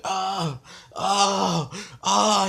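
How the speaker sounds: rising series of mock 'ah' cries; imitated pained scream. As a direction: act out the burning kid's yells. Imitates burning boy crying out.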